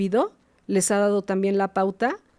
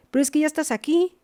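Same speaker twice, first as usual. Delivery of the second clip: higher, quick mock protest; quoted psychologist; 7 semitones higher, faster than usual. Psychologist pressing a reluctant child, high hurried insistence. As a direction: imitate the psychologist pushing back, pitched up and hurried. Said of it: "ella me dice".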